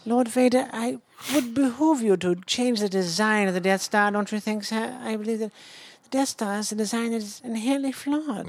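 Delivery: a British officer reporting to Lord Vader; British aristocrat. Acted. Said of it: "a British aristocrat".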